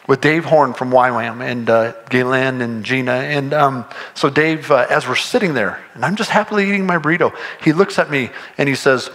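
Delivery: usual speaking voice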